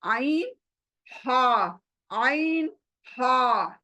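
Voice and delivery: हा breathed from mid-throat; throat letter. Shown as a demonstration. Teacher showing the throat letter to the class. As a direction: push the h from the middle of the throat. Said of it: "गले के बीच के जो letters हैं".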